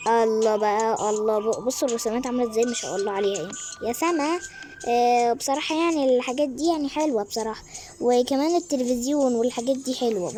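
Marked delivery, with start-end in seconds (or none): none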